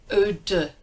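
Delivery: eau de as O then a neutral de; French eau de. Shown as a demonstration. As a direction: say eau de smoothly. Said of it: "Sort of unstressed de".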